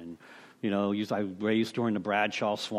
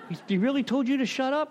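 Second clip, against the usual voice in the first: incredulous question; 13 semitones higher, 3 dB louder than usual. Retold or imitated. Friend asks about the shushing.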